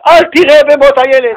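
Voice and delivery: high, loud and slow pleading cry; mock shout. Preacher voicing what we would say, shouting. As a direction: cry it out like urging a man to drown with them. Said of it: "ואנחנו היינו אומרים".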